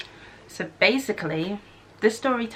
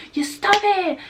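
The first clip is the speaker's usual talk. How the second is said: higher, louder imitated stop it; mock shout; 13 semitones higher, 5 dB louder, slower than usual. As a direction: imitate her yelling at him, a little hammed-up. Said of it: "I started hearing".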